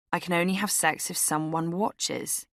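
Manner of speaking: ordinary delivery, nothing marked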